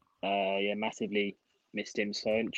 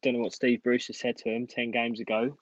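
ordinary talk

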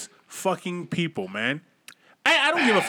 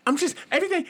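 mock upset girl; 10 semitones higher, much faster than usual. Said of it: "a girl was having a bad day".

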